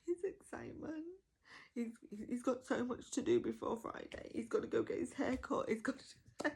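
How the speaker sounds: breathless fan retelling; giddy excitement. Retold or imitated. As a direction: gush like the kid can't wait for Friday. Gushing about a character's excitement.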